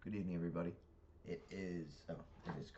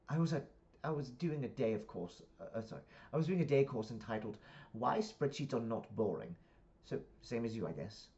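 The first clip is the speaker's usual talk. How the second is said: lightly acted UK accent; Pippa in British accent; 7 semitones higher, 8 dB louder than usual. Daughter telling parent about a course, British accent. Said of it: "I speak in the accents 'cause it's U K".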